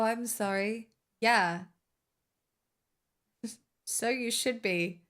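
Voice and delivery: ordinary talk, nothing marked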